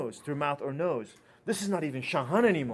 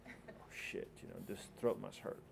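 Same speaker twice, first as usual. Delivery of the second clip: acted under-the-breath worry, much softer; barely voiced start, then low and soft; hushed dismay; 5 semitones lower, 13 dB quieter than usual. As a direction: say it as an appalled private thought. Doctor's alarmed inner thought at patient.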